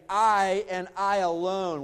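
slow "I and I alone", both I's leaned on; emphatic. Shown as a demonstration. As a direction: spell out "I and I alone" slowly and firmly. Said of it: "this is even in the emphatic".

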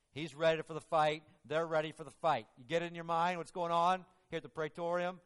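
ordinary talk

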